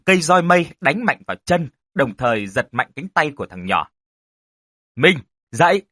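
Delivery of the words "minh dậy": raised, forceful wake-up command; growled shout. Acted. Uncle rousing the boy from a nightmare, growled shout. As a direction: shout it higher, each word punched. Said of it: "Chú Tư gằn giọng hét lớn".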